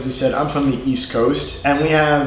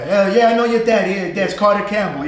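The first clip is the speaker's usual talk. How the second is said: put-on light New York accent voice; 6 semitones higher than usual. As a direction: play a local New Yorker chatting. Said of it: "They would say Carter".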